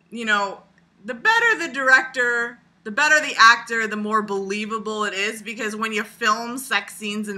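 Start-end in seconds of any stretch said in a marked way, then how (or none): none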